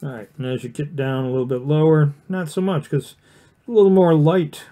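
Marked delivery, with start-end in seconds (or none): none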